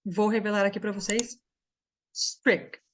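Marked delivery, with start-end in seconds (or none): 2.1-2.8 "Strict" said without its last T; silent final T. Shown as a demonstration. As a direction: stop "Strict" on the K, "strik". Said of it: "Esse T aqui é mudo".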